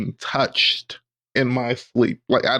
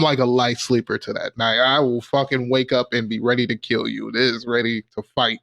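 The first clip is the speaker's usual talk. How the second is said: ordinary talk, nothing marked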